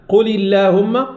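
«قل اللهم» with a thin, light L; lam leve. Shown as a demonstration. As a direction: say the L of اللهم lightly, not velarized. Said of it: "نرقق اللام".

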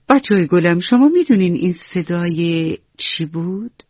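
ordinary delivery, nothing marked

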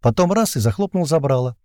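ordinary delivery, nothing marked

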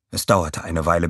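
ordinary delivery

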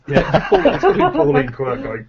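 laughing through the words; giggling as you say it. Real, not acted. Laughing while retelling.

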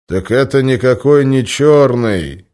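loud, high, slow declaration; loud announcement. Acted. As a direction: announce it slowly to the whole room. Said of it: "громко объявил он".

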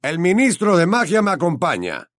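urgent message from a patronus; Mr Weasley's voice. A narrator's reading. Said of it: "habló con la voz del señor Weasley".

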